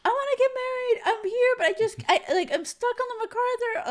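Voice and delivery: high-pitched frantic phone message; panicky, high, stumbling plea. Retold or imitated. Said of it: "I left a frantic message".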